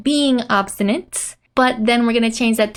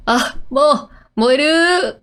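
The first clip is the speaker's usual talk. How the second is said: stammering alarm, rising higher on the last word; panicked stutter; 4 semitones higher, slower than usual. Acted. Torako seeing her car burn, stuttering in panic. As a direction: stumble into a startled cry. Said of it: "is just she's stuttering".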